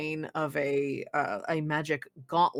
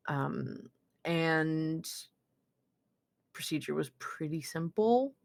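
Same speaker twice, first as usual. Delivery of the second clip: ordinary delivery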